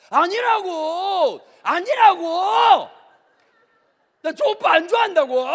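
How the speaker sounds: high, loud, drawn-out protest; frantic denial shout. Acted. Girl teased about liking him, loud frantic denial. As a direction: yell the denial as if caught out.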